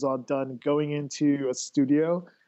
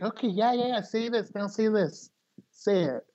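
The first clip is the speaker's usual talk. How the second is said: higher, put-on bossy studio director voice; mock pushy director; 6 semitones higher than usual. Director pushing the host through takes, higher mock bossy voice. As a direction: rattle off orders like an impatient director. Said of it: "it's like".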